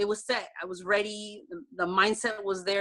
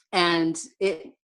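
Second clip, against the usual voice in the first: normal talking voice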